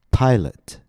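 "Pilot" high on the first syllable, then falling; stress on first syllable. Shown as a demonstration. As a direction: stretch and lift the start of "Pilot". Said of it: "the first syllable is stressed".

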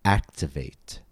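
Activate with stress on AC; stress on first syllable. Shown as a demonstration. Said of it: "it falls on the first syllable".

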